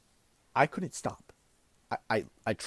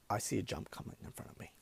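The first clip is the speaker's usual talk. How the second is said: normal talking voice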